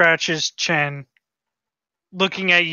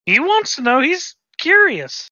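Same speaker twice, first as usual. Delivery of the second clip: in-character voice; 11 semitones higher, 3 dB louder than usual. Acted. Player voicing Uthel in character.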